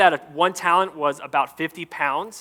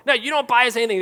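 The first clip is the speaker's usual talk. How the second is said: put-on grumpy kid voice; 8 semitones higher, faster than usual. Grumpy daughters complaining.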